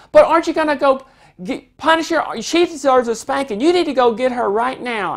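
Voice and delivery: put-on a child insisting sister be punished; child demanding that mom spank her sister. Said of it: "If the child says".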